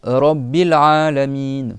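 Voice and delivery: slow loud "Rabbil Alamin" with rolled r; slow Quranic Arabic; Arabic recitation. Shown as a demonstration. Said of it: "C'est pas".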